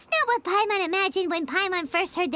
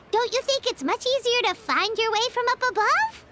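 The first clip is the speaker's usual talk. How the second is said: ordinary talk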